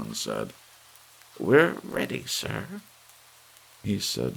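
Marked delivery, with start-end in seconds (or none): 1.4-2.8 much higher, louder then softening; old lady calmly ordering departure; old lady's voice. A narrator's reading. Missus Bellows to Thurkell.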